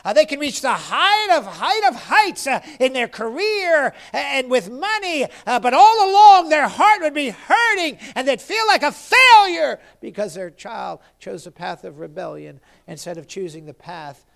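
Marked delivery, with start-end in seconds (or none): 0.0-9.8 high, driving pulpit cadence; impassioned preacher in full flow; fervent preaching. Real, not acted. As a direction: hammer each repeated phrase, building to a loud peak.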